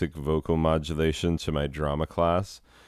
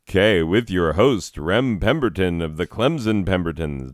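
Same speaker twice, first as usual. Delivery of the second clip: louder presenter intro; mild host voice; 2 semitones higher, 5 dB louder than usual. Acted.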